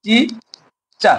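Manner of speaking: "টি, চা" with a clear pause between syllables. Shown as a demonstration. Teacher demonstrating two syllables, "টি, চা" split apart. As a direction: break it as "টি", pause, "চা". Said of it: "দুই উচ্চারণ বিশিষ্ট শব্দগুলোকে".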